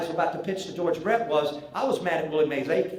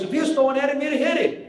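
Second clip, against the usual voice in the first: mock yell; 9 semitones higher, 3 dB louder, faster than usual. Rose arguing with Frey.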